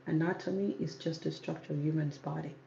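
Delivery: ordinary delivery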